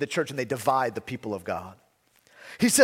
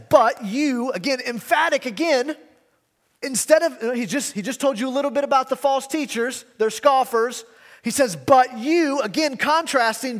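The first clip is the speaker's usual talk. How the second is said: higher and louder, hammering the contrast; emphatic preaching; 8 semitones higher, 5 dB louder than usual. Acted. Preacher stressing the apostle's contrast to scoffers, emphatic. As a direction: drive home the "but you".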